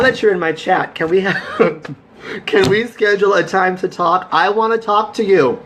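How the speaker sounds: laughing. Real, not acted. Laughs asking friend to talk.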